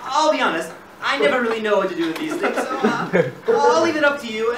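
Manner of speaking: ordinary talk, nothing marked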